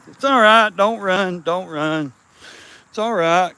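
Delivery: high, loud coaxing call. Real, not acted. Man calling to fleeing kittens not to run. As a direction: call out to stop them running.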